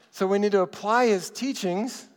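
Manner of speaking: usual speaking voice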